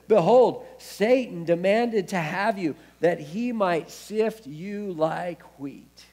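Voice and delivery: loud, high opening slowing right down to a heavy finish; ringing scripture quote, slow and weighty; solemn proclamation. Retold or imitated. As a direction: proclaim a grave warning, drawing it out. Voicing Jesus warning Simon with weight.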